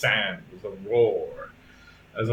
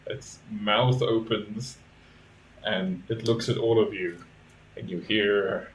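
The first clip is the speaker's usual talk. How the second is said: usual speaking voice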